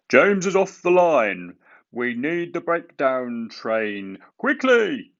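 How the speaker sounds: high, loud urgent shout. A narrator's reading. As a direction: call out the alarm urgently. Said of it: "he shouted".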